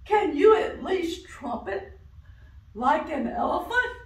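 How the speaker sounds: loud, high elephant. Acted. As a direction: challenge like a big elephant. Elephant challenges duck.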